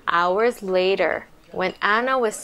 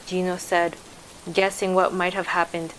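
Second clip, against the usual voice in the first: plain reading voice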